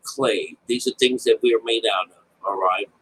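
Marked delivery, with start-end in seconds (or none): none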